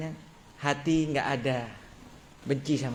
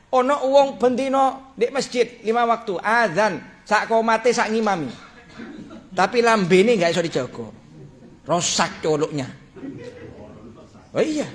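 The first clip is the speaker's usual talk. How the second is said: fervent preaching; 5 semitones higher than usual. Acted. Preacher tells the congregation of the sheikh's regret, fervent preaching. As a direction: drive the lesson home with fervour.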